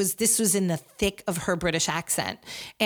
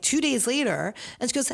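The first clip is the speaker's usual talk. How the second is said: usual speaking voice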